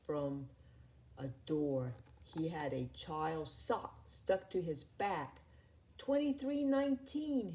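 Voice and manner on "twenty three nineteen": high, frantic alarm call; screamed alarm. A narrator's reading. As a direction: yell the code out in panic. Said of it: "his assistant screamed".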